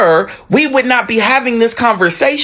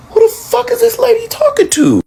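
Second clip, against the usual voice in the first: high disbelieving squawk; way up in shocked disbelief; 13 semitones higher than usual. Retold or imitated. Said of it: "And I'm like".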